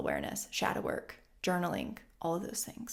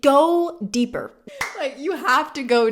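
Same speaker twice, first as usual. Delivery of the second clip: louder, higher, slow push; forceful urging; 6 semitones higher, 8 dB louder, slower than usual. Acted. Urging emphatically.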